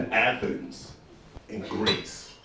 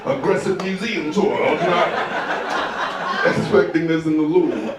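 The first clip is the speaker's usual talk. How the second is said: high, slow sarcastic surprise; taken-aback tourist; 8 semitones higher, 6 dB louder, slower than usual. Retold or imitated. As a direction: react politely stunned. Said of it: "You're like".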